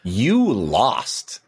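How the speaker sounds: imitated gloating child, starting high; playful preschooler taunt, each word leaned on; retold four-year-old. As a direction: rub it in like a little kid who beat Dad. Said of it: "My four-year-old".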